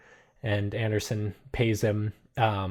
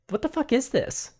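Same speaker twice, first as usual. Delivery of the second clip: shocked Rose; 12 semitones higher than usual. Acted. Rose finds burner phone.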